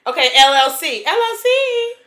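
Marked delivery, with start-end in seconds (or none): 1.1-1.9 L L C pitched up high; playful show-off repeat. Pretend. Rich friend joking about money, L L C said high and showy. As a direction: flaunt L L C in a high voice. Bragging joke, repeat played up.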